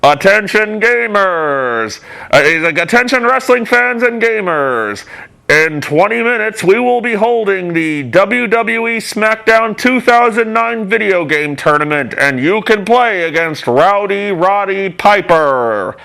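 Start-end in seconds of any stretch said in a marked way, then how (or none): 0.0-2.0 slow, booming arena announcer call; imitating the PA announcer, drawn out. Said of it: "there was this asshole on the P A system". 2.8-16.0 slow, higher arena announcer voice; mock stadium PA announcement. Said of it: "there was this asshole on the P A system".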